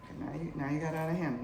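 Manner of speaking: ordinary delivery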